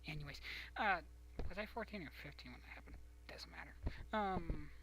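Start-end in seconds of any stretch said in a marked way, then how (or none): 1.5-3.7 quick whispered aside. Real, not acted. Wondering aloud to herself in a whisper. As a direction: whisper it fast to yourself.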